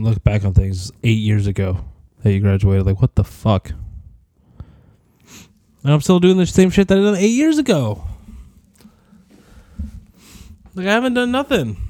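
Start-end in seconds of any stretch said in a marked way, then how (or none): 5.8-11.8 high-pitched, incredulous exasperation; exasperated complaint. Real, not acted. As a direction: let the pitch climb in disbelief at yourself. Exasperated at own lack of progress.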